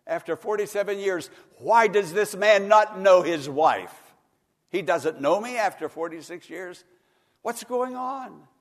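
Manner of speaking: preacher crying out a grieving wife's words; distraught wife's plea. Retold or imitated. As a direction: wail it like a wife whose husband no longer knows her. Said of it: "she said".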